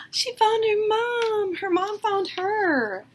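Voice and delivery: high, slow, overjoyed voice. A narrator's reading. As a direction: say it slowly, bursting with joy. Narrator overjoyed reveal.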